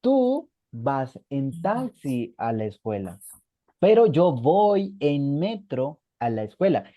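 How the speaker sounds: spoken slowly, clause by clause; teacher giving an example sentence; slow dictation. Shown as a demonstration. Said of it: "Si yo quiero decir esto".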